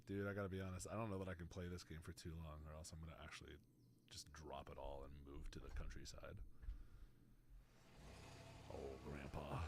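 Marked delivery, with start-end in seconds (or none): 2.0-9.6 soft, low, near-whispered daydream; very quiet, breath-heavy aside; hushed musing. Pretend. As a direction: drop down to a wistful murmur. Dreamy aside, then wistful sigh.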